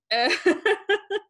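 high burst of laughter; short high giggle. Real, not acted. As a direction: laugh at your own joke. Laughing at own story.

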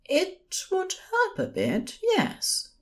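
high, calm nurse voice; gently composed, high-pitched. A narrator's reading. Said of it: "Aziraphale spoke calmly".

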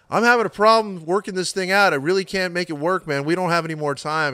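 high-pitched imitation of a hassled soundman; sound man's excuse. Sound man telling the singer it won't work, mock hassled. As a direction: call it out high, as if stuck on a problem.

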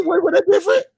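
high incredulous retort. Retold or imitated. To girlfriend at the KFC window, high incredulous retort.

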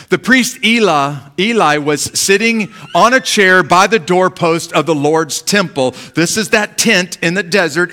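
fervent Bible reading; scripture reading. Acted. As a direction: proclaim the passage to the congregation. Reads the scripture passage aloud.

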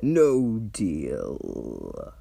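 immovable archdevil, flatly refusing; dragon voice. Acted. Mammon refuses the haggling.

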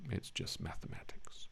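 whispered aside; hushed throwaway remark. Real, not acted. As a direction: drop to a breathy whisper for the joke.

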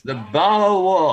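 drawn-out Boston "The Boer War", "pah waw"; Boston accent. Pretend. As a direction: stretch "The Boer War" without the r, Boston-style. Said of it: "That's a very good Boston accent".

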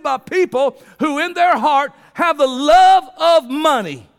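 loud, high preacher's cry; full-force preaching. Acted. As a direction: preach it out with fire to the congregation.

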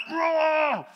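high playful mock roar of effort. Pastor imitating himself, a playful mock roar. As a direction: roar like the bike is a beast. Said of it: "and I went".

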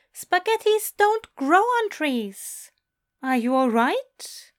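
high, slow, baffled angel voice; incredulous correction. Acted. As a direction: correct him slowly, high-pitched, then check on him.

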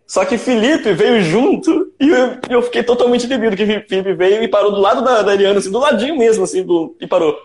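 laughing. Real, not acted. Embarrassing retold moment, laughing.

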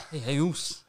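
playful imitation of a Pacific Islander accent; mock islander accent. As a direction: tease a mate who acts like an islander, Pacific Islander accent. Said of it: "he thinks he's an islander".

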